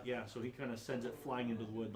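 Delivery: normal talking voice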